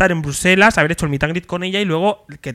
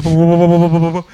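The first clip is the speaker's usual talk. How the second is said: pounding heartbeat syllables, louder; heartbeat noises; 5 dB louder than usual. Retold or imitated. Nervous fan mimicking her pounding heart before meeting idol.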